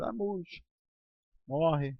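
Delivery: normal talking voice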